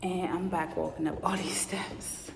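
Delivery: soft unvoiced whisper. Real, not acted. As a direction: whisper it late at night. Late night climbing stairs.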